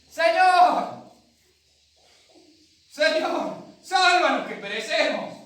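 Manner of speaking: high, very loud desperate yell; shouted plea. Acted. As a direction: scream for rescue over the storm. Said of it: "le pegaron un buen grito".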